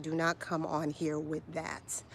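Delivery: each word spaced, NOT leaned on; slow it down and stress not; telling off a commenter, slow and firm; slow emphatic warning. Real, not acted.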